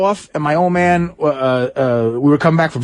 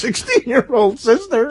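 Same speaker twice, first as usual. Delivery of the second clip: ordinary talk, nothing marked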